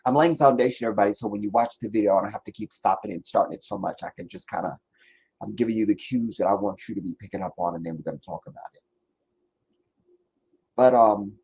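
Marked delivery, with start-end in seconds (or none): 0.8-8.8 quick quiet aside. Real, not acted. Rapid aside to viewers.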